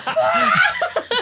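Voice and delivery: high-pitched excited whoop breaking into laughter; hyped-up squeal. Retold or imitated. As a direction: let out a high, laughing cheer. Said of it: "it got me so hyped. I was like".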